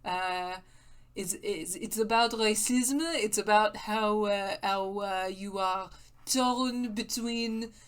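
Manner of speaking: lightly put-on mock French accent, halting and hesitant; bad French accent. Gamer rambling about androids in a bad mock French accent. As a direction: play a pretentious French critic in a deliberately bad French accent. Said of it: "it's a good mock French accent".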